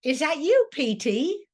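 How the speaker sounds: Meg calling. Acted. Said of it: "and she says".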